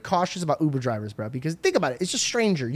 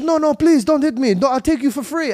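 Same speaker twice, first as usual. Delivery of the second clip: high-pitched, panicky begging; frantic pleading; 8 semitones higher than usual. Retold or imitated. Driver begging passenger not to hit him, high and frantic. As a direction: beg in a high voice.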